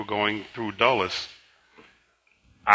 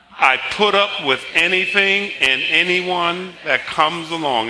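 raised, measured declaration in one run; confession recitation; 7 semitones higher, 4 dB louder than usual. Acted. Pastor leading the church's confession, continuous declamatory recitation. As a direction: declare it in one steady sweep.

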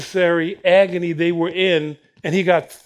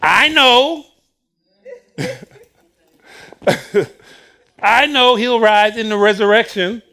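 mock Martha; 4 semitones higher, 5 dB louder than usual. Martha voiced confidently.

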